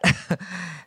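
a small chuckle after an understatement. Real, not acted. Speaker admits to congregation it smelled pretty bad, short chuckle.

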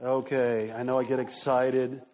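low, level, deliberately calm voice; exaggerated calm. Shown as a demonstration. Preacher assuring congregation he isn't yelling, mock calm. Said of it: "lower my voice and be real calm".

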